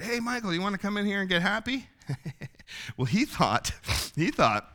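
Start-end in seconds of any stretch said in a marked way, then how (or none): 0.0-1.9 high-pitched call across the street; retold street yell. Church guy hailing Michael across the street, mock yell. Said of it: "yells across the street".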